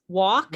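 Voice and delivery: "walked" shortened to one beat, wawkt; one syllable. Shown as a demonstration. Teacher contrasts the endings, "walked" as one syllable. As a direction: say "walked" with no extra vowel before the final t. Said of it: "is one syllable".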